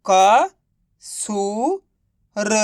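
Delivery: teacher's spelling-out with gaps between क, सू and र; syllable by syllable. Shown as a demonstration. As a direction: sound out क, सू, र separately, each drawn out. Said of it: "तो बताइए मिलके क्या बनेगा?". (क, सू, र को अलग-अलग खींचकर)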